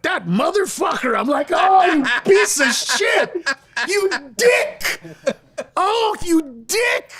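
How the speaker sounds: high, loud, slow comic outburst at a friend; hammed-up fury, stretching each insult; mock outraged yell. Retelling outrage at joke thief.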